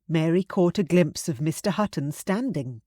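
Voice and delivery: ordinary delivery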